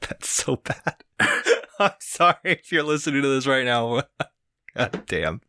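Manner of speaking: laughing under the breath; trying not to laugh; stifled giggles. Real, not acted. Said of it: "I'm trying not to laugh".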